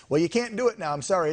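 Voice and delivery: usual speaking voice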